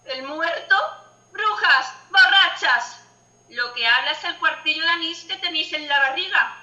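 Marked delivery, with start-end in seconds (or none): 1.3-6.6 angry shouting. Acted. Widow scolding the mourners.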